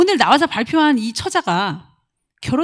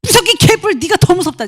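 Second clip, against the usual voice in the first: high, loud, scornful snap; husband hitting back; hammed-up angry husband; mock angry retort; 9 semitones higher, 7 dB louder than usual. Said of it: "그런 말을 들었지만".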